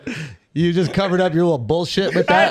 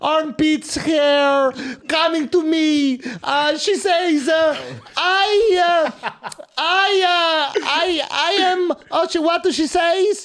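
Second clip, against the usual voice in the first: put-on slow, high Italian-accented storytelling; drawn-out, high-pitched Italian accent; hammed-up Italian accent; 8 semitones higher, slower than usual. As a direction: agonise through it like a baffled cartoon Italian. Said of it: "I had to snap out of that one".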